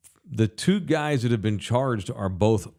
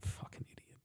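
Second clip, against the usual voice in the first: a scornful whisper under the breath; disgusted whispered insult; whispered aside; 21 dB quieter than usual. Real, not acted. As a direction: drop it to a whisper, just for yourself. Muttered aside about the shooter.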